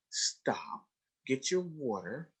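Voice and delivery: voiceless, soft, slow hush; quiet private instruction; hushed stop. Retold or imitated. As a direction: stop him gently under your breath. Quietly redirecting student.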